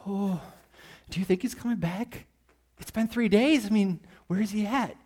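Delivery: high, hushed, nervous mock worry; mock scared disciples. Preacher voicing disciples behind locked doors, mock frightened and hushed. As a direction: play the panicky friend hiding in the room. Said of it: "they're probably like".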